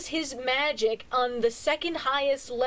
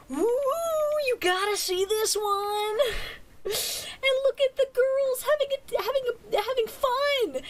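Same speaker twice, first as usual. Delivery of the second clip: high, gushing fangirl voice; excited; 10 semitones higher than usual. Real, not acted. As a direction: squeal with delight over it. Gushing about the anime.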